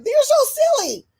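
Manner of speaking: exaggerated high-pitched exclamation; mimicked schoolmates. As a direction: imitate tickled kids in a high voice. Mimics reaction to her accent act.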